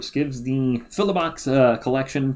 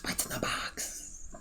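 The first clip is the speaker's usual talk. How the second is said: spooky whisper; 16 dB quieter, slower than usual. Pretend.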